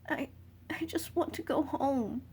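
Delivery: tearful whispered plea, slow; choking on tears, barely voiced; crying. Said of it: "you cry".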